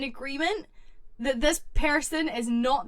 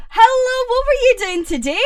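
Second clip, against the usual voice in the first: higher, louder, eager question; excited hello; 10 semitones higher, 7 dB louder than usual. Real, not acted. Streamer greeting Pepsi, loud and eager. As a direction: greet a friend with a burst of energy.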